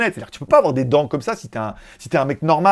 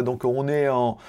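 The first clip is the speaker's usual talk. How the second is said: ordinary delivery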